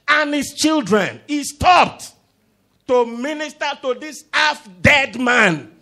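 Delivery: high, loud declaiming; emphatic preaching shout. Real, not acted. Preacher tells congregation David stopped, shouting emphatically. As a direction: drive it home at full force.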